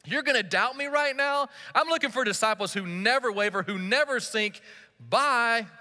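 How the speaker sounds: high, incredulous, mocking disbelief; a fed-up, dismissive teacher scoffing at the sinking man; sarcastic Jesus. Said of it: "Jesus doesn't look over at him and say".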